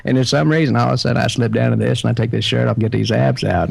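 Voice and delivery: laid-back Southern movie-star impression; Matthew McConaughey. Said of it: "that's Matthew McConaughey".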